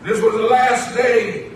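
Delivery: measured, projected sermon cadence; short phrases with long pauses for the congregation; preaching. Acted. Preacher tells the church no one speaks like Jesus, preaching.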